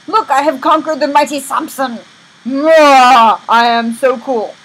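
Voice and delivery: loud, pompous gloating with a big blurted cry; hammed-up royal bragging; boastful king. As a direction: show off grandly to your guests. King boasts to dignitaries, acted.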